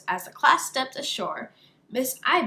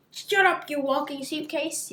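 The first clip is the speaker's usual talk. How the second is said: villain yelling; 5 semitones higher than usual. Acted. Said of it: "yelled the bad guy".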